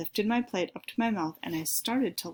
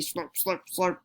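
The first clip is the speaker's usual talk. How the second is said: mock slurping. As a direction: imitate noisy slurping to tease. Sister mocks slurping noises.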